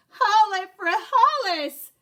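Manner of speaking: high, loud triumphant yell; battle cry. Acted. Scipito thrusting his sword at the bandito, loud battle cry. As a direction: cry it out as you strike. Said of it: "cried Scipito as he thrust".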